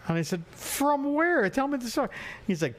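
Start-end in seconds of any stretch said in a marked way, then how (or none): 0.5-2.1 high-pitched mock astonishment; incredulous questioning. Friend hearing of the adoption, incredulous. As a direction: press for the story in a much higher voice. Acting out his surprised reaction to the reveal.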